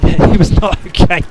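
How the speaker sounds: loud, high, drawn-out words through laughter; laughing. Real, not acted. Gamer killed right after spawning, real laughing. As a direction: burst out laughing while admitting disaster.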